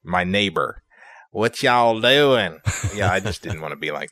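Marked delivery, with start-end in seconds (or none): 1.3-2.6 slow, drawn-out Southern drawl; mock a Southern neighbor's thick drawl; long-voweled Southern accent, hammed up. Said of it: "not sound like my neighbor".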